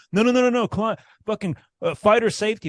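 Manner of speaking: high, mocking no-no-no; whiny mock referee, pitched high; mocking the referee who stopped the fight, in a high whine; mocking the ref.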